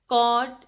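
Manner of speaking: कॉट said loud with a hard t at the end; stressed ending. Shown as a demonstration. Teacher drilling word endings, loud. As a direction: hit the final consonant. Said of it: "ड का sound देंगे".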